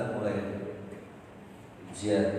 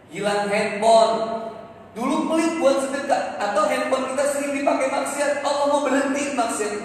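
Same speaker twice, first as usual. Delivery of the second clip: raised, ringing sermon voice, higher and louder; preaching; 13 semitones higher, 9 dB louder than usual. Acted. Preacher exhorting the congregation on lost property, full force. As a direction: preach it out to the crowd.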